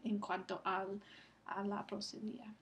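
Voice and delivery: normal talking voice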